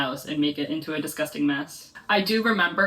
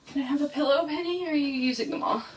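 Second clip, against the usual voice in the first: higher, sing-song pet talk; asking the dog for a pillow in higher pet talk; 9 semitones higher than usual. Real, not acted.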